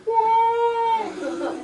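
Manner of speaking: high, loud awed cry; amazed whoa. Retold or imitated. Little brother awed by Gina's jump. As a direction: gape in wonder.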